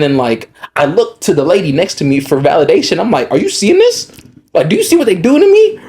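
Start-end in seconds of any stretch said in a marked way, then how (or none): 3.3-4.1 high-pitched disbelief; incredulous appeal. Retold or imitated. 4.5-5.8 high, outraged disbelief; incredulous appeal. Retold or imitated.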